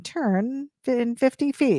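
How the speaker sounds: GPS voice. Retold or imitated. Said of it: "a voice saying".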